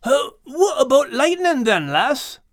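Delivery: Scottish man voice. Acted. Max's line.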